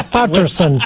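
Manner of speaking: ordinary talk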